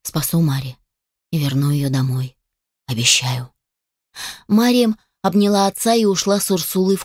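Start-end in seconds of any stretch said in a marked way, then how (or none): 0.0-3.5 resolute promise. A narrator's reading. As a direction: promise it firmly in a lowered voice. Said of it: "решительно сказал". (решительно, низким голосом)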